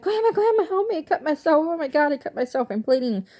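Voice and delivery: high, loud mock panic cry for help; fake panic. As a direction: pretend to be hurt and scared. Faking an injury to grandma.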